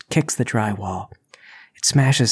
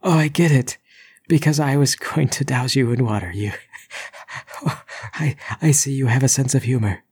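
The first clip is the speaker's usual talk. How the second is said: nervous laugh; 3 semitones higher than usual. Acted. Intruder drenched, laughing nervously.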